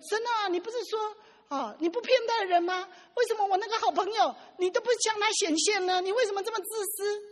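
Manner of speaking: put-on childish pleading. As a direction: complain to God half-crying, a spoiled child. Pastor pleading childishly with God. (像小孩一样带着哭腔、提高音调向神撒娇抱怨)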